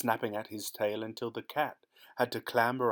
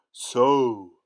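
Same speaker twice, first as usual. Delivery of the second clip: loud booming ogre bark; ogre roar; 2 semitones higher, 12 dB louder than usual. Acted. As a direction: roar the word at the cat. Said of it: "roared the ogre".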